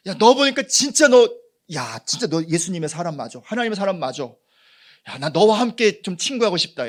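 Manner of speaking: high, loud, eager begging; pleading excited friend. Retold or imitated. Friend begging to be taken to church, high and loud. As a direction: beg like a friend who wants what you have. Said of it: "지금 말로 어떻게 말할 수 있나요".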